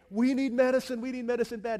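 loud, high-pitched mock begging; hammed-up cry for help from the sick; hurting villagers pleading. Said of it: "they're, like, wheezing and hurting".

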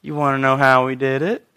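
low, soft taunt with a lilting rise; sly sarcasm. Acted. Peter answers grumbling priests, slyly sarcastic. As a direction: tease them with a suppressed chuckle. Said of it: "they're probably grumbling, and Peter goes".

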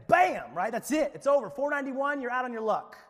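loud, high explosive "bam" and verdict; shouted, hammed-up final verdict; mock cutoff. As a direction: slam the door on them. Acted sudden cutoff at a limit.

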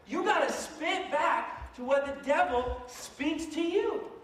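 high, loud, slow preacher push; slow rising cry to the congregation; fervent preaching. Real, not acted. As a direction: drive it home from the pulpit. Preaching at full force.

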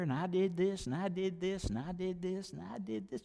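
a repeated phrase dying away; fading repetition. Shown as a demonstration. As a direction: let it fade to almost nothing.

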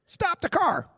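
high caricature of an aunt's order; mock aunt calling out. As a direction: call it out high, hamming up the aunt. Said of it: "She doesn't really talk like that".

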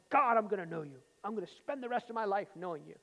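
a loud, earnest vow to God; fervent loud prayer. Retold or imitated. Alone in a dorm room, vowing to God, loud and fervent. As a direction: cry it out like a young man's first vow.

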